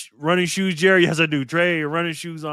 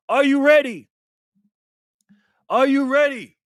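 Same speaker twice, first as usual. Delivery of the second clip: high, loud rallying call; game-show host rousing the audience; hype call-out; 8 semitones higher, 4 dB louder than usual. Real, not acted. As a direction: fire up the crowd, pitched high. Hyping viewers before prize spin.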